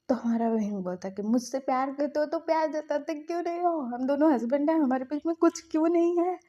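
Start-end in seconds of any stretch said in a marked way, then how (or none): 1.2-6.4 higher and louder, hurt accusing complaint; playing the upset spouse demanding answers, voice rising; retold angry outburst. Wing confronts husband in anger. (नाराज़ होकर ऊँची आवाज़ में शिकायत)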